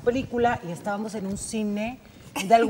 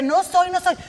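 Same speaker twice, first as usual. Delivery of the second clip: protesting; 8 semitones higher, 5 dB louder than usual. Retold or imitated. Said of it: "Y yo les decía".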